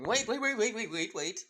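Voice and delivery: high, loud rush of waits easing at the end; panicked stop-them cry, acted out; frantic interruption. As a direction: urgently call a halt, pitch jumping up. Jack hastily stops the countdown.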